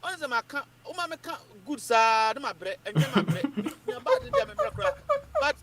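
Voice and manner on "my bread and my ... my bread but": laughing. Real, not acted. Laughing mix-up of words.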